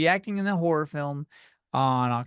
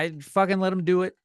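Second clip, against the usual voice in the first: ordinary talk, nothing marked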